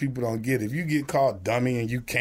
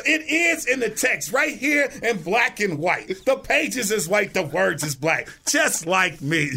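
high, slow mock preaching; drawn-out sermon-style delivery; preacher voice; 7 semitones higher, slower than usual. Mock sermon reply to teacher.

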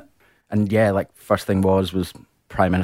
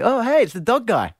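mock cheery politician greeting, pitched higher; hammed-up friendly greeting, voice up high; imitating ScoMo. Former Prime Minister greeting the dog guy, in a hammed-up high mock voice. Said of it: "see ScoMo and he was like".

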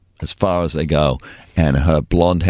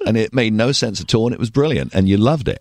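ordinary talk

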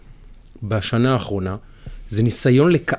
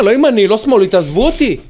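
raised, higher-pitched protest; acted exasperation; 6 semitones higher, 5 dB louder than usual. Voicing a friend refusing to take political sides, exasperated. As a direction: push them away, fed up.

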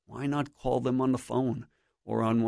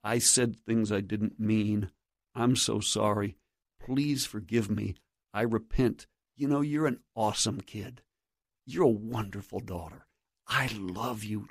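softer, tender parental apology; humble apology; 3 semitones lower, 3 dB quieter than usual. Acted. Parent asking a child's forgiveness, humble and tender. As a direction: say it gently and sincerely, a little lower. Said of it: "humble ourselves before our children and say".

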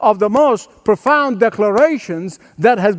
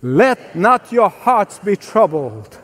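slower still, said with the crowd; slow call-and-response scripture line; slow recitation; slower than usual. Shown as a demonstration. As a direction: recite it together, drawn out. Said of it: "Can you say that with me?".

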